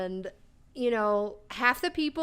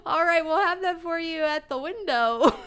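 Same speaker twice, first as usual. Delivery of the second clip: laughing quote; 6 semitones higher, 3 dB louder than usual. Retold or imitated. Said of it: "And she goes".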